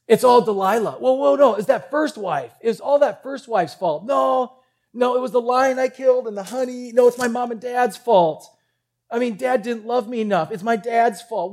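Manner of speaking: high, hurried string of excuses; blame-shifting. Acted. Samson at the pillars blames everyone else, whiny protest. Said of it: "I wonder what Samson thought".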